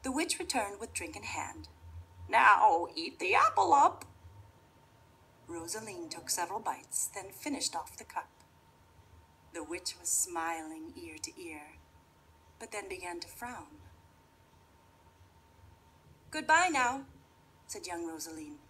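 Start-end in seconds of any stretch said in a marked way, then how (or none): 2.3-4.0 witch voice. Acted. Witch commands. 16.3-17.1 put-on cheerful girl voice. Rosaline leaving.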